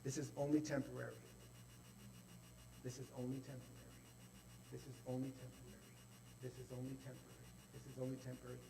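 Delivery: very soft, low, repeated like a mantra; a hushed, steady voice fading almost to nothing; small voice from the light. Retold or imitated. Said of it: "I hear a voice that says".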